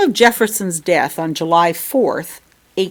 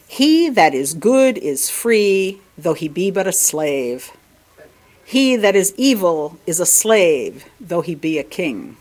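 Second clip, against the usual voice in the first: slow solemn quote. Acted. Quoting Augustine slowly.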